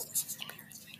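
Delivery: urgent embarrassed whisper; frantic whisper. Real, not acted. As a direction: whisper it fast so nobody hears. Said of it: "Why are you whispering?".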